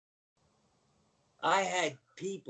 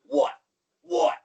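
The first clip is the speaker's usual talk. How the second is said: sharp, high, punched question; Randy Savage; 10 semitones higher, 10 dB louder than usual. Retold or imitated. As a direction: bark it like a larger-than-life wrestler. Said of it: "Randy Savage used to always look at me and go".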